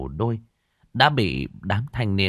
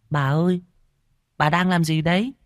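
put-on high-pitched boy asking with curiosity; curious boy; 10 semitones higher than usual. A narrator's reading. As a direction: pitch it up like a puzzled child. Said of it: "Thằng Bách tò mò". (giọng cậu bé tò mò, cao)

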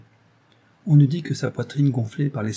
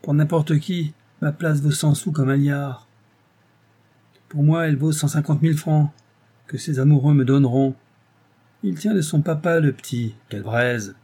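put-on higher-pitched, gloating old rogue; grumbling schemer; 4 semitones higher, 3 dB louder than usual. A narrator's reading. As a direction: mutter greedily, pitched up. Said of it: "grommelait-il".

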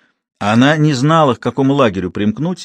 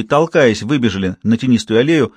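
ordinary delivery

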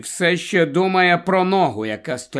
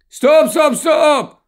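much higher, louder cry of stop; shouting stop; 13 semitones higher, 7 dB louder, slower than usual. A narrator's reading. Helen calls out to stop the station wagon, shouting. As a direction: halt the car with a yell. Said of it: "гукає Гелен".

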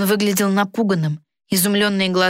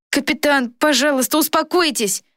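put-on high, coaxing boy's voice; pleading boy; 8 semitones higher than usual. A narrator's reading. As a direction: plead with the captain in a high boyish voice. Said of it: "уговаривал мальчик". (высокий умоляющий голос мальчика)

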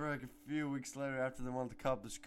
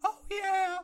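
excited cheer; 6 dB louder than usual. Real, not acted. Celebrating the cup win.

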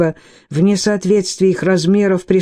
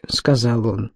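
plain reading voice

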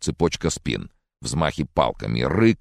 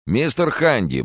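raised, pointed form of address; meaningful tone; 9 semitones higher, 3 dB louder, slower than usual. A narrator's reading. Reporter turns to his interviewee, meaningful high-pitched tone. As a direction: address the guest with significance. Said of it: "многозначительным тоном обратился".

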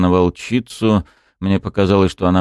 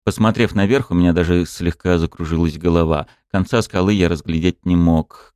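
ordinary delivery, nothing marked

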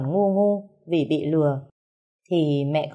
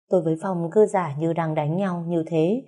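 ordinary delivery

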